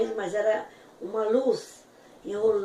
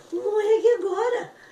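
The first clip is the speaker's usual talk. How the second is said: much higher, louder awestruck outburst; half-panicked cry of awe; overwhelmed cry; 12 semitones higher, 7 dB louder than usual. Retold or imitated. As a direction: gasp it out, overcome with awe. Overwhelmed hearing Jesus speak.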